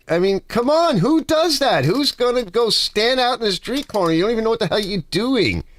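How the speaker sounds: high, mocking rant; mock clueless guy.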